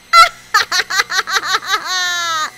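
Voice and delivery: long villainous cackle; evil laugh. Acted. Kidnapper escaping with the child, evil cackle. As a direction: gloat with a rolling laugh.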